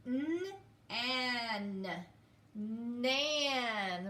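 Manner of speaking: N, an, nan split into sounds then blended; sounding out. Shown as a demonstration. Teacher showing how to sound out, slow and separate. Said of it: "sounding out some words".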